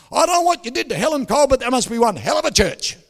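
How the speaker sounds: high, loud, fast exclamation; amazed shout. Retold or imitated. Returning barber hailing the preacher about changed colleague, amazed shout. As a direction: burst out loudly in astonishment.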